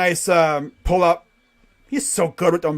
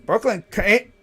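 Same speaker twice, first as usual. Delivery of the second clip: ordinary talk, nothing marked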